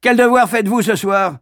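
high, loud curt question; irritable schoolmaster; gruff master. Acted. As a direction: brush the complaint aside. Master changes subject.

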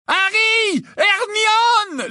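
loud, very high whoop of names; overjoyed yell. A narrator's reading. Ron yelling to his friends, overjoyed. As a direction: call out wild with joy. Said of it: "leur cria Ron, fou de joie".

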